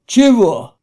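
loud, falling, indignant outburst; short loud objection; Fat man's protest. A narrator's reading. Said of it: "Толстый тоже не остался в стороне".

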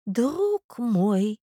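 put-on high, drawn-out old prince voice. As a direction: plead gently as a doddering prince. (старый князь, высоко и протяжно)

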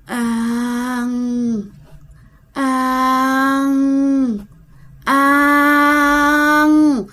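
long eerie moan from a starving ghost. Retold or imitated. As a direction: moan each word like a hungry spirit. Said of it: "nó rên thấy ghê lắm". (tiếng rên rợn người của con quỷ đói)

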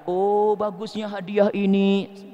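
slow, drawn-out admiration; slow, mock-enraptured praise of a gift; admiring the gift. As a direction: gush over the present and forget the giver. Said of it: "Anda hanya mengatakan".

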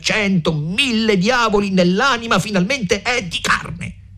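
high-pitched raging rant, acted out; angry outburst. A narrator's reading. Renzo rants about his enemy to the women, angry outburst.

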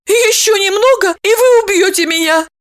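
very high, squeaky frightened woman; frightened babble. A narrator's reading. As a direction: squeak it out in fear. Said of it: "испуганно пролепетала".

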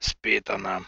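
low, heavy father voice; tired low sigh of a father; weary father. Acted.

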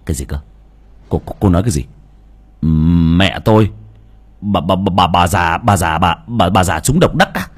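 stuttering in shock, repeating bà; tripping over the words in disbelief; stammering. Acted. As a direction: son stammering on hearing of the jackpot. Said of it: "lắp bắp".

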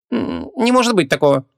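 high, affronted shopkeeper; tut-tutting disbelief at the price tag; indignant. A narrator's reading. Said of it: "зацокал языком возмущённый продавец".

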